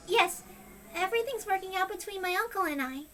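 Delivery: put-on frightened girl repeating what she's told; young girl voice. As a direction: answer like a scared child. Sadako answers caller.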